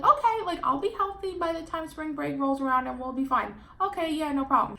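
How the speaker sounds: high, loud mock-breezy agreement; mock carefree reply to the doctor's office; sarcastic okay.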